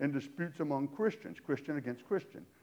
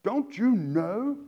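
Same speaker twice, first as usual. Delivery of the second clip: slow, louder pointed question; attention-grabbing rebuke; 4 semitones higher, 7 dB louder, much slower than usual. Retold or imitated. Paul rebuking the church to get attention, slow pointed question. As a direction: lean on it to wake them up. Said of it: "trying to get their attention".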